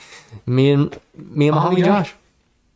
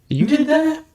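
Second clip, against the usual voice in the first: much higher, incredulous question; stunned disbelief; 12 semitones higher, slower than usual. Retold or imitated. Beaten man asking the narrator, shocked disbelief. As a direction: voice the victim's shock.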